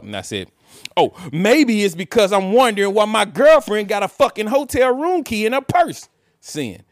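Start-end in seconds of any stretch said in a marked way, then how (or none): 1.0-6.1 louder, higher sarcastic retort; retold angry reply to girlfriend; sarcastic anger. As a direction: bite back with sarcasm.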